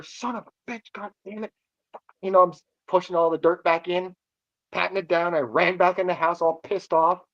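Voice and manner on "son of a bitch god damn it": softer, cursing under the breath; muttered curses. Retold or imitated. Angry teen mutters while filling the hole, under the breath. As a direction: grumble to yourself while stomping over.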